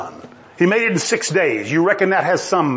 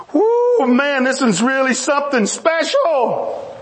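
high whoop, then slow, emphatic delight; thrilled God; 6 semitones higher, slower than usual. Acted. God admiring his finished world, whooping with delight. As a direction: whoop and marvel at your own work.

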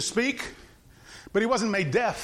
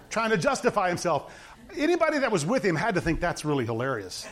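usual speaking voice

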